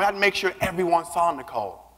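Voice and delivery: normal talking voice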